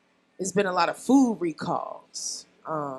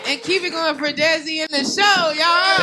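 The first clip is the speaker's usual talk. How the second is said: rallying the crowd; 5 semitones higher, 5 dB louder than usual. Real, not acted. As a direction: whip up applause for the performers. Comic rallying crowd for applause.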